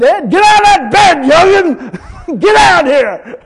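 loud, high mama shouting her son out of bed; mama yelling. Retold or imitated. Preacher imitates his mama calling him out of bed, high loud shout. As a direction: holler up the stairs like a stern mama, laughing at the end. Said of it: "Mama said".

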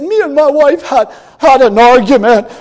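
lightly voiced whimpering minister; a hint of a self-pitying preacher; whiny minister. Preacher mimics the minister asking for prayer, lightly whiny. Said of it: "whimpering and whining to himself".

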